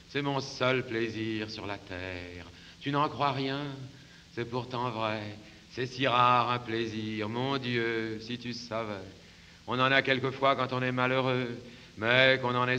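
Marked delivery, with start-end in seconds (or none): none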